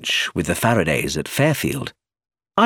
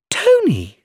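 higher, louder glad cry of a name; surprised cry; 13 semitones higher, 5 dB louder than usual. A narrator's reading. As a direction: call out his name in surprise. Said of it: "she cried".

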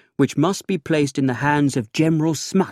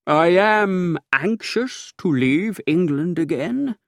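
slowed, higher measured reply; slow, deliberate; 5 semitones higher than usual. A narrator's reading. Narrator slowly agrees to leave England soon. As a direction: weigh each word. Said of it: "I said slowly".

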